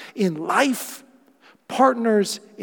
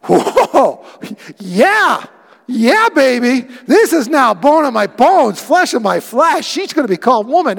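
high, loud, thrilled whoa; delighted Adam; 6 semitones higher, 11 dB louder than usual. Acted. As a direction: sell the man's wide-eyed delight, loud and high. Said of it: "No, he went".

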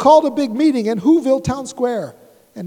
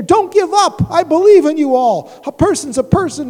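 much higher, louder call of encouragement; rally them from afar; 6 semitones higher, 7 dB louder than usual. Acted. Horton calling to the mayor while caged, higher and louder. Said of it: "managed to call to the mayor".

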